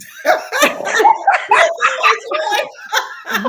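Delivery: high loud burst of laughter; laughing hard at the joke. Real, not acted. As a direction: crack up loudly.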